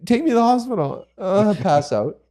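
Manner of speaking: high-pitched, self-mocking helpless whine; whining baby. Carpenter mocking himself over a hurt finger, babyish whine. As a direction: play up the feeble, fainting baby. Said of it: "Just baby".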